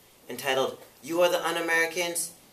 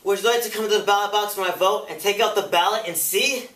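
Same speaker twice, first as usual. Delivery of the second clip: higher-pitched, challenging retort; indignant witness; 6 semitones higher, 6 dB louder than usual. Acted. Robeson answering the committee's repeated question, defiant. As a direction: throw it back as a pointed rhetorical question.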